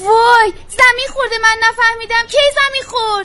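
loud, high cry of shock; alarmed shouting. Acted. Girl learns her mother fell, shouting in alarm. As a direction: burst out in alarm at the news.

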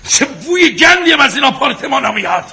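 high, loud disgust; angry rant. Acted. Husband ranting about the stinking apartments, high angry shouting. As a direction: rail loudly at the stink. Willy rants, wife calms him.

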